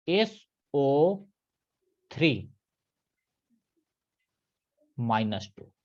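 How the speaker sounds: very slow, spelling out each symbol; drawn-out dictation of the formula. Shown as a demonstration. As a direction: dictate it piece by piece so they can write. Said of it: "ये बात ध्यान रखना".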